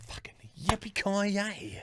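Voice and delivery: breathless whispered triumph, slow; a hushed, drawn-out yippee-ki-yay; whispered cheer. Real, not acted. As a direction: whisper it like a secret victory. Quiet celebration after the call.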